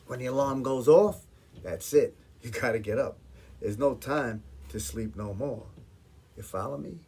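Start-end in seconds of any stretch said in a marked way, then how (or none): none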